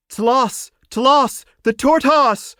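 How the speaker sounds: high, loud excited cry; joyful cry. A narrator's reading. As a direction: shout his name so all take it up. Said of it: "cried Gamella".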